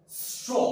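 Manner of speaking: "स्ट्रॉ" with a long s before ट्रॉ; teacher sounding out "स्ट्रॉ" for children; modelled reading. Shown as a demonstration. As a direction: stretch the sss, then release into ट्रॉ for "स्ट्रॉ". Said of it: "जब स का sound आया".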